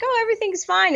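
sing-song high mock of feeling fine; breezy fake-cheerful oh everything's fine; mock cheery. Patient mimics her own early denial, mock cheery. Mock carefree self-quote.

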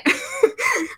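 high bubbly laugh; burst of laughter. Real, not acted. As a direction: laugh out at the memory. Laughs about quitting her job.

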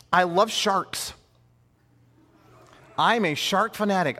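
usual speaking voice

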